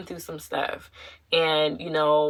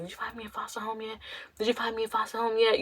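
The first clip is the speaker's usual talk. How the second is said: quick, high, small-voiced pestering; imitated pleading; 5 semitones higher, 9 dB quieter, much faster than usual. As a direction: rush it softly, like an anxious kid on the phone. Retelling her anxious calls to social worker.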